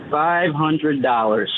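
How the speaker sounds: slow, drawn-out words; deliberate price-naming. Acted. Kid names his price to the hiding man, slow and deliberate. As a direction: stretch out each word as the punchline price.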